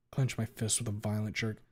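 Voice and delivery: usual speaking voice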